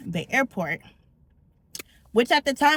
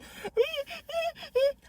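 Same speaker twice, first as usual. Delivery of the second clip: muffled cry; 7 dB quieter, much slower than usual. Retold or imitated. Muffled while being attacked.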